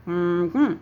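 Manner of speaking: two distinct hummed beats; word hummed with the mouth shut; closed-mouth hum. Shown as a demonstration. Teacher asks how many sounds in wagon, two-beat hum. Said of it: "say the word with your mouth closed".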